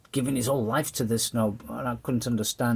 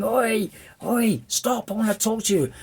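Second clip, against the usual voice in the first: shouting after him; 10 semitones higher than usual. Retold or imitated. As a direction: yell after someone running away. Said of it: "I'm screaming".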